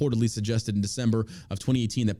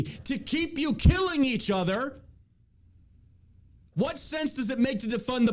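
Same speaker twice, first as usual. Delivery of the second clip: impassioned appeal; 8 semitones higher than usual. Acted.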